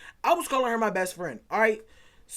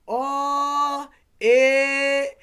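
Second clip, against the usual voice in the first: high, loud mock yell of a kid; kid brother's outburst; 7 semitones higher, 10 dB louder than usual. Little brother yelling at his sibling's girlfriend on FaceTime, loud and high. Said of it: "my little brother was like".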